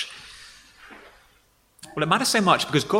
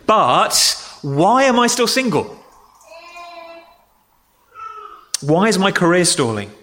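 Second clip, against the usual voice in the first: whiny complaint; 7 semitones higher than usual. Acted. Grumbler's complaint.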